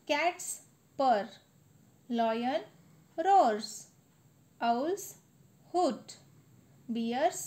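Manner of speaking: sing-song classroom recitation, each word held; poem recitation. Acted. Teacher reciting the animal poem to students, sing-song. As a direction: chant the rhyme for the class to repeat.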